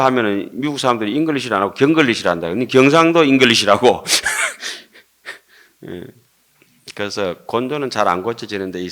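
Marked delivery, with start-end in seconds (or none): 4.1-4.8 brief amused chuckle; real short laugh. Real, not acted. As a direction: chuckle at your own joke. Laughs at own English joke.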